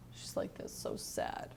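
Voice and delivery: hushed, voiceless aside; sad whisper. Acted. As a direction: whisper it in sympathy with the wilted girl. Shows how sad the wilted girl is.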